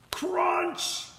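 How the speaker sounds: sudden high shouted "Crunch!". Acted. Narrator shouting the crunch as the finger gets hurt. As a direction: blurt it out like a sharp crash. The thing breaks on her finger.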